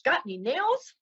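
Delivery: high, perky little duck voice; duck in a joke. Acted. Duck asking the bartender, high and innocent. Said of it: "the duck walks in and he says".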